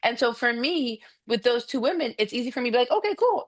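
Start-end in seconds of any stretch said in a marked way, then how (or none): 2.9-3.4 breezy. Retold or imitated. Breezy acceptance.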